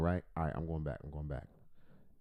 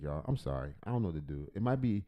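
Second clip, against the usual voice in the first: ordinary delivery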